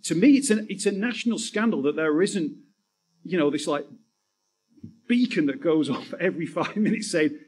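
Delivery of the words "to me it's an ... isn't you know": ordinary delivery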